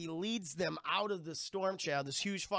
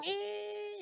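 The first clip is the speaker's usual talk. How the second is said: very high, held squeal; mock squeal, pitched way up; dwarf's squeal. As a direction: squeal like a dwarf being flung about. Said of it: "The little dwarf's going".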